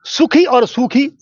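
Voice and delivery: सुखी with a short u, सूखी with a long drawn uu; contrasted vowel length. Shown as a demonstration. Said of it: "इसी तरह से क और ह".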